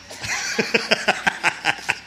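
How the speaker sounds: soft unvoiced chuckle; quiet chuckle. Real, not acted. Father laughing at his own empty threats, soft chuckle. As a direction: laugh under your breath at yourself.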